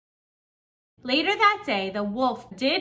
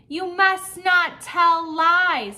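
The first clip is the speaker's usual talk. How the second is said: slow, loud, raised-pitch scolding; villagers' scolding; 7 semitones higher, 7 dB louder, slower than usual. A narrator's reading. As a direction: scold the boy slowly and emphatically. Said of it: "said the villagers".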